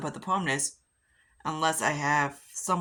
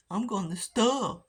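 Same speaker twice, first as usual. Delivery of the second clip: mocking the neighbor; 6 semitones higher, slower than usual. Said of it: "I'm like".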